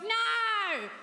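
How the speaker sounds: high, mock shrieking heckler. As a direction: squeal it like a demanding heckler. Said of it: "She's like".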